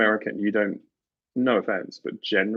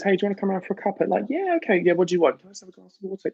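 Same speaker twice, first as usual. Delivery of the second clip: chirpy invitation; 10 semitones higher, much faster than usual. Retold or imitated. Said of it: "But if someone says".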